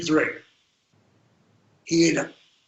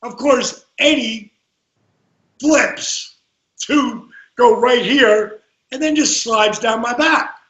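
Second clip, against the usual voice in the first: ordinary talk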